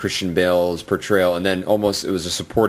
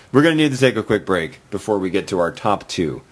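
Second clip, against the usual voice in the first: ordinary talk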